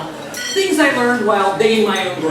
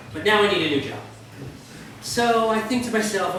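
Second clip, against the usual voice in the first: ordinary talk, nothing marked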